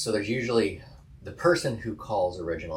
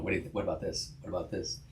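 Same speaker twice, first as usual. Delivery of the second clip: tentative suggestions; 9 dB quieter, much faster than usual. Retold or imitated.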